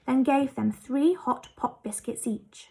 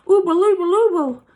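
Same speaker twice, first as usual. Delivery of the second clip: higher, louder alarmed cry through toffee; alarmed mumbling cry; 7 semitones higher, 9 dB louder than usual. A narrator's reading. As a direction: grab Joe and protest with a full mouth. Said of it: "he cried".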